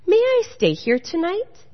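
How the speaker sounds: mildly voiced shivering wren; shivering bird. A narrator's reading. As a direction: ask for shelter with a light shiver.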